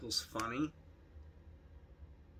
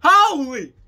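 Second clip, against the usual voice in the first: very loud, high reenacted yell; yelled outburst; 10 semitones higher, 15 dB louder than usual. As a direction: scream at the top of the lungs. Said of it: "I yelled".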